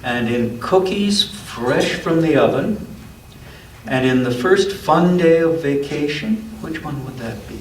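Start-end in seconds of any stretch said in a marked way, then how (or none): 0.0-6.4 gentle storybook read-aloud; unhurried picture-book narration; reading to a child. A narrator's reading.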